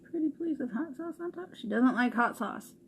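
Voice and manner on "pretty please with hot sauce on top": higher, mock-childish begging; imitating the child. Mom mimicking daughter's begging to a friend, childish. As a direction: parrot the kid's plea in a small voice. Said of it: "This is what I have to deal with".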